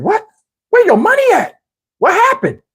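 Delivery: high, startled disbelief; shocked. Retold or imitated. He reacts to girlfriend demanding money, high and shocked. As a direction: squeak it out in shock.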